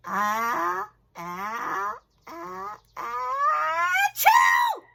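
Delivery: big sneeze building to a shouted achoo. Shown as a demonstration. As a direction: build up the ah's then burst into a loud achoo. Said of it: "you say".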